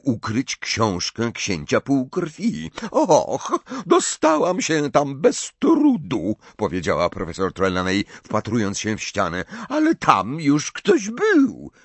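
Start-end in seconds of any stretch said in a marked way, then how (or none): 2.9-11.7 Professor Trelawney. Acted. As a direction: play the tipsy, offended seer, pitched way up.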